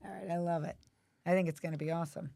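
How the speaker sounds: ordinary talk, nothing marked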